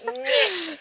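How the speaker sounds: high-pitched mock "meh" of distaste; mock disgust. Game master teasing a player about the smell, mock disgusted.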